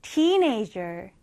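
put-on slow, careful teenager; teacher's model reading. Shown as a demonstration. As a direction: say teenager slowly, syllable by syllable. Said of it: "읽을 때는".

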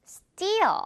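Steal high then falling, t like 띠; stretched Steal with no puff on the t; tense-t steal. Shown as a demonstration. Teacher repeats the model, tense-t Steal. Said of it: "이렇게 띠 발음이 난다는 거".

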